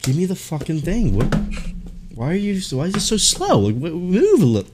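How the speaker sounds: high-pitched, exasperated muttered plea; impatient griping. Acted. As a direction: act fed up with waiting. Acting out impatience with slow staff.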